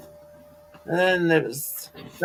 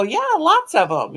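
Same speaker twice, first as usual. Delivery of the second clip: cheerful high reply; 10 semitones higher, 3 dB louder than usual. Retold or imitated. Retold own cheerful answer.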